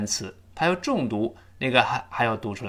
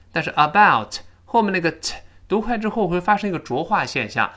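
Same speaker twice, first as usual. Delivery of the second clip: ordinary delivery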